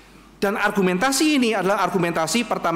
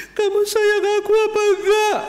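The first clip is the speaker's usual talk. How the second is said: high, loud sulky whine; mock whiny lover; 6 dB louder than usual. A lover pesters her partner, in a hammed-up high whine. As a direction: ham up a jealous lover's pout.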